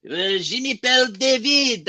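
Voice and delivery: put-on a deliberately poor French accent; bad French accent. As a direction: put on a clumsy French accent to greet listeners. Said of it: "I cannot do a French accent".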